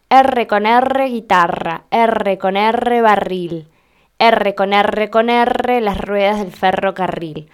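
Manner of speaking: chanted rhyme with each "R" strongly trilled as "erre"; rolled-R tongue twister. Shown as a demonstration. Versito for chicos to repeat, "R con R" with rolled trills. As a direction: roll every rr through "guitarra, barril, ferrocarril". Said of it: "un versito que hacemos que los chicos repitan".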